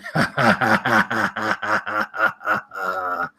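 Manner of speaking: long rattling undead cackle; villainous laugh. Acted. Frozen King dodging the attacks, long mocking villain laugh. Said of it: "the son of a bitch laughs".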